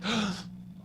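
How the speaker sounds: sharp high gasp of realization. Retold or imitated. Kid realizing homework is his trigger, gasping. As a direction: gasp as it clicks. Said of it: "you're like".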